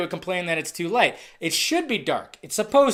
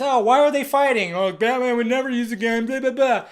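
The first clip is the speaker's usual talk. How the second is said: whiny complainer; 4 semitones higher than usual. Retold or imitated. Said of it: "griping about the same shit".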